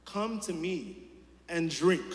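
high, loud call, slow on anyone thirsts; crying out. Acted. Jesus calling to all who thirst, a loud high cry. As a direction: proclaim it to a crowd. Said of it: "He cried out saying this".

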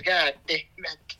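usual speaking voice

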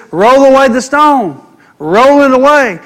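high, loud, slow command; righteous conscious Jesus. Acted. Jesus ordering the stone moved at the tomb, loud commanding preacher's voice. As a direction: declare it with full authority. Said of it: "being righteous conscious, says".

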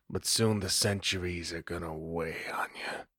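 whispered, dark prophecy; vampire whispering a dark prophecy to Paige; ominous whisper. Acted. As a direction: breathe the warning close to her ear.

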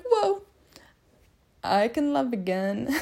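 high, bright gasp of wonder on whoa; a retold rush of delight at feeling love again; amazed realization. Telling a friend about falling in love again, amazed. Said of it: "And I was like".

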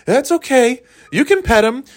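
high falsetto dog-owner voice; imagined bike owner. Pretend. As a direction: reassure strangers in a silly high pitch. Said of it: "I'm picturing him, like".

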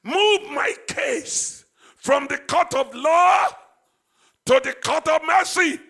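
loud, slow, pleading prayer cry; shouted prayer. Acted. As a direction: cry out the plea with full force. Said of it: "I'm just talking that way to put some emphasis".

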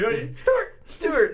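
mocking whine. Mocks Stewart's name.

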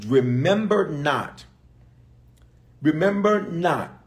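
slow, each word pressed down; slow weighted scripture. Shown as a demonstration. Preacher quoting God's words to viewers, slow and weighted. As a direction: let the verse land slowly. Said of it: "I'm just giving you Bible".

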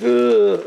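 disgusted groan. Retold or imitated. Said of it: "they're like".

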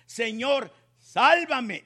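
crying out. A narrator's reading. Peter sinking in the sea cries out loud for help. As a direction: shout for rescue as you sink. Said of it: "diciendo a grandes voces".

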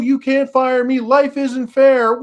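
high, loud, whining plea; mock crying, begging not to be fired; whiny mock employee. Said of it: "Can you imagine it?".

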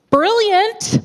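high, loud burst of inspiration; excited. Acted. Child seizing on a dog plan, excited. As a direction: exclaim it like a brainwave.

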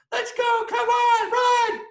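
very high, loud yelled escape call; frantic shout. Retold or imitated. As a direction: scream at your friend to run. Kids running from yelling principal.

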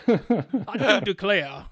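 slow, loud, high Southern drawl; mock Southern accent. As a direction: declaim it like a genteel Southerner. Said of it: "everybody in North Carolina's foghorn leghorn".

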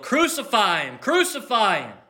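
mock mob yell; crowd yelling. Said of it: "as the crowd yelled".

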